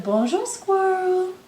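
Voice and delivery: lightly voiced lion, rising high on birds; a friendly lion calling hello; lion's greeting. Storyteller voicing the lion greeting the birds, bright and lifting.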